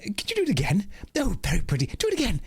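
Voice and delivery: high, excited character voice; high-pitched pleading delight; delighted mistress character. Acted. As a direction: play a batty mistress thrilled by a trick. Voices mistress amused by spell.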